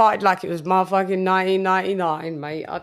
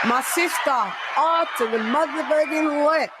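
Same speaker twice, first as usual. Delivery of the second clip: hype shout-out; 4 semitones higher than usual. Real, not acted. Hyped roll call shout.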